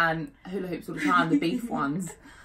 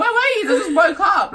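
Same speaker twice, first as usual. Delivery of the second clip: excited; 11 semitones higher, 7 dB louder than usual. Retold or imitated. Retold upset exchange.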